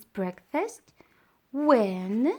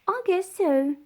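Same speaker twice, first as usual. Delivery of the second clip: sighed; 8 semitones higher, 5 dB louder than usual. Acted. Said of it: "sighed Caterpillar".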